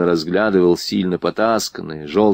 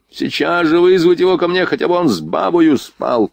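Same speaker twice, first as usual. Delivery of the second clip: haughty rebuke climbing in pitch; imperious drunk prince, berating; 5 semitones higher than usual. Acted. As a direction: scold an underling, then demand obedience.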